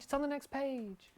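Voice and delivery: high, soft sing-song repeat; put-on silly voice. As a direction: chirp it in a silly voice.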